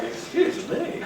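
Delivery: high, slow, amused "excuse me"; laughing mock retort. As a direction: scoff through a laugh. Mocking a demanding person.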